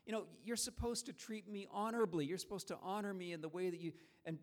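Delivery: a father hurt and scolding his son; upset father. Retold or imitated. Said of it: "He was really upset".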